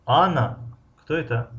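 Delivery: strong rise-fall on Анна; stress on the first word. Shown as a demonstration. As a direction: hit Анна hard and let кто это drop away. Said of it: "агар дар интонатсионная конструкция ду".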